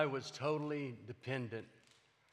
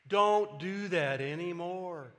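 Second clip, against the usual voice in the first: firm warning; 4 semitones higher, 5 dB louder, slower than usual. Real, not acted. Warning against morphine again.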